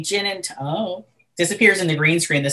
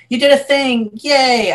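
bright, higher cheer for a creator; cheery praise; 8 semitones higher, 4 dB louder, slower than usual. Retold or imitated. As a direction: cheer them on like a proud friend. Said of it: "I'm just kind of like".

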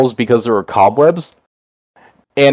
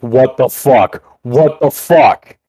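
slow stunned disbelief; much slower than usual. Retold or imitated. Panicked as paramedics arrive.

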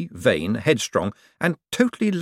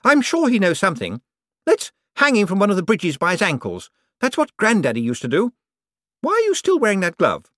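shrill demands pitched way up; Poochie's voice; 10 semitones higher than usual. Acted. To Cosmo, a greedy high-pitched rich woman.

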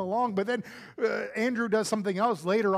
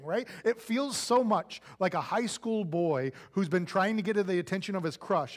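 ordinary talk, nothing marked

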